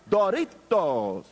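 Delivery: put-on slow Spanish-accented Doritos with a rolled r, "Doritos". Boyfriend teaching girlfriend on the phone, slow Spanish Doritos. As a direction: say "Doritos" slowly the Spanish way, trilled r. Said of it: "how to say Doritos in the proper phonetic Spanish".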